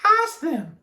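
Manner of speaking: high-pitched character voice; Amelia Bedelia. A narrator's reading. Amelia Bedelia to Alkaloo, high character voice. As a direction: say it up high at normal volume. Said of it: "said Amelia Bedelia".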